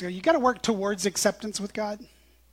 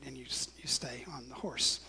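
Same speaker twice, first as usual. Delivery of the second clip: whispering; 6 semitones lower, 11 dB quieter than usual. Real, not acted. Quiet aside after the joke.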